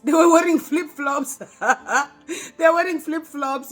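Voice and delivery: loud, high-pitched laughing disbelief; incredulous laughing. Real, not acted. Telling viewers about the flip-flops, loud incredulous laughter. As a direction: crack up loudly while saying it.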